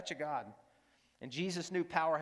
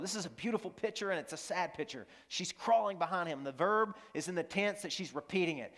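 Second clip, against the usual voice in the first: normal talking voice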